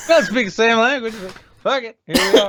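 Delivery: high, loud devil-may-care; careless shrug. Retold or imitated. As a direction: toss it off with reckless cheer. Imitating someone shrugging it off.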